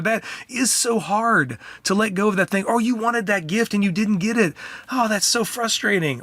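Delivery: empathetic parent voice. Retold or imitated. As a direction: soothe a child who missed a gift. Dad voicing empathy to child.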